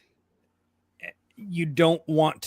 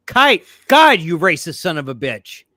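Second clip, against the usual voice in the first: mock outrage; 7 dB louder than usual. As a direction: exclaim it like a joking accusation. Mock outrage at misheard word.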